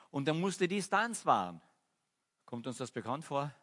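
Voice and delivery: ordinary talk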